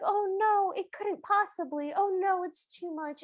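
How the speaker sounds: high-pitched mock helplessness; protesting Cinderella. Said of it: "Cinderella's the one that's like".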